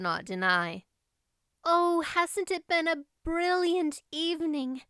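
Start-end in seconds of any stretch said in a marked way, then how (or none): 1.6-4.8 lightly voiced girl, higher, on a happy sigh; sighing Anne. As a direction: breathe it out like a dreamy girl after the show. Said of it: "sighed Anne".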